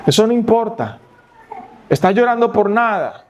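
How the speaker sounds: desdén agudo, algo más fuerte; padre desdeñoso. Retold or imitated. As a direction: despacha el llanto como sin importancia. Padre minimiza el llanto. (padre que minimiza el llanto del hijo, desdeñoso)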